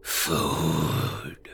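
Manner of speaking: slow, soft creature whisper; dark whisper behind you. Acted. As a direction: coax the boy, hungry and slow. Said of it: "the shadows whispered".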